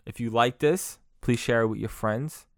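ordinary talk

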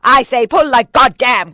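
forceful, rough-edged yell; high, explosive shout; loud cry. A narrator's reading. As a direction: roar back an answer to the challenge. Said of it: "cried the Indian".

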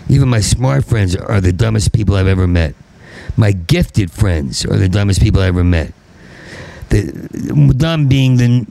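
ordinary talk

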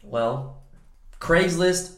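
ordinary talk, nothing marked

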